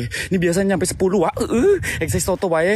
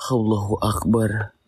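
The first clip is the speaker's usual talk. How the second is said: fearful prayer; 10 semitones lower than usual. Real, not acted. Praying in fear.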